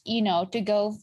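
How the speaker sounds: normal talking voice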